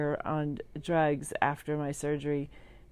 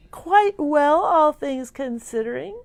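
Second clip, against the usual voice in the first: bright cheerful; 9 semitones higher, 9 dB louder, slower than usual. Real, not acted. Cheerful sign-off.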